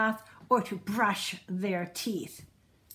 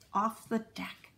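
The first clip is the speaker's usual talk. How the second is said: ordinary delivery, nothing marked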